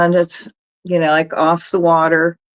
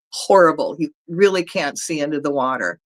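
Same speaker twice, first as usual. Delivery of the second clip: ordinary delivery, nothing marked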